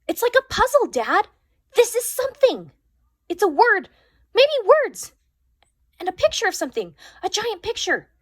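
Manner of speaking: high, loud and breathless with discovery; excited. A narrator's reading. Julia telling her dad the pictures form a puzzle, thrilled. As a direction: spill the words out thrilled.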